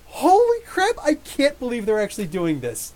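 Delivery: high, loud burst of disbelief; amazed shout. Retold or imitated. Vlogger reliving his amazement at Mister Mind, high excited yelp. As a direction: yelp it out like you can't believe it. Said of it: "I'm just like".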